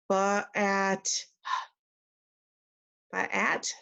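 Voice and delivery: "at" sounded out slowly and unsure; sounding out wrong. Shown as a demonstration. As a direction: sound out "at" piece by piece, puzzled. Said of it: "I'm gonna sound this part out".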